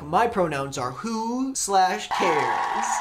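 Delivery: slow, dismissive put-on voice; mock blunt bigot. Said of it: "you should just be like".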